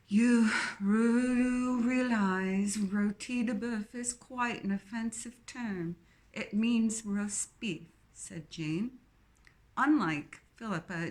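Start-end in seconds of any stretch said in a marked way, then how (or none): 0.1-7.9 put-on a plummy English accent dripping with snobbery, slowing down on the last words; snobbish Queen's English. Jane correcting Philippa, snobbish in a posh English accent. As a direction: look down your nose, very Queen's English. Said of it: "peppered with exaggerated snobbery".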